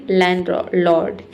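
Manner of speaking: लैंडलॉर्ड with no first D, "lan-lord"; silent D. Shown as a demonstration. Teacher models it for students, लैंडलॉर्ड with silent D. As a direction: drop the D of land in लैंडलॉर्ड. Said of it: "ड के sound को हटा देना है".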